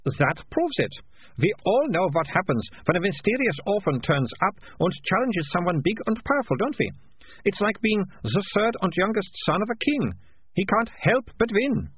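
put-on high, lilting know-it-all girl; triumphant Malicia. A narrator's reading. Girl telling the crowd the orphan must win, triumphantly. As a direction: prove your case to everyone, pitched high.

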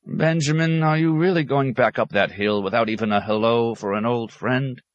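high-pitched call after a friend; hurt, calling appeal; appealing abbot. A narrator's reading. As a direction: plead with him as he leaves. Abbot calls after departing hermit.

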